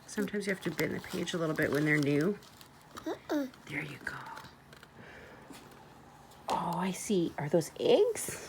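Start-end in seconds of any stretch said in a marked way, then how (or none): 3.3-8.5 whispering. Real, not acted. Whispering while reading with toddler.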